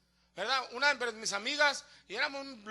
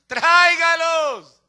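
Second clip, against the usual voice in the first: high, loud, stretched-out command; drawn-out preacher's call; exhorting shout; 4 semitones higher, 12 dB louder, much slower than usual. Real, not acted. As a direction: urge the congregation slowly and forcefully.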